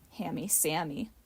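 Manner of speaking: soft, low snickering taunt; snickered under breath. A narrator's reading. A little boy mocking Sammy, soft snicker. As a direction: mutter it with a snicker. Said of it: "snickered under his breath".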